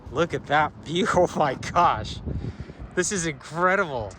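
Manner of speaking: loud, amazed gasp at the view; breathless wonder at scenery; awed excitement. Real, not acted.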